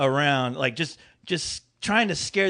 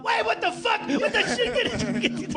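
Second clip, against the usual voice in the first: much higher, shrieking mock mom; Corey's mom. Friend imitating Corey's mom bursting out, high and shrill. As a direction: squeal it like a startled mom. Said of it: "burst out like Corey's mom".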